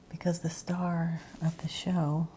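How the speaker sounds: normal talking voice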